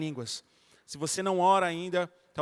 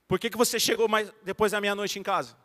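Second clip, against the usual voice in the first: stern correcting; 2 semitones higher than usual. Shown as a demonstration. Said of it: "quando eu vou corrigir a Nicole".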